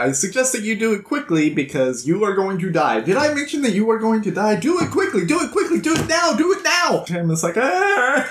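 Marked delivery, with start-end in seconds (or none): 0.0-7.0 building to a high, insistent chant; urgent compass voice. Retold or imitated. Compass urges Tim across the bridge. 7.6-8.3 high, drawn-out wail; mock panicked cry. Tim panics at the compass's nagging.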